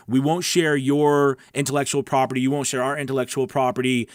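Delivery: ordinary talk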